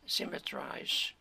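normal talking voice

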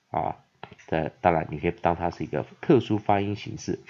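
ordinary talk, nothing marked

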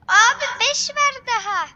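high, loud eager shout; excited call. Real, not acted. Gamer to his friend, high loud eager shout.

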